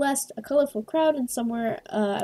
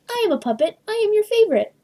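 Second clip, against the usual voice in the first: high, louder puppet boast breaking into a laugh; giggly puppet voice; 12 semitones higher, 5 dB louder, faster than usual. Acted. The puppet boasting to a player, high and giggling. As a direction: play the puppet, proud and giddy.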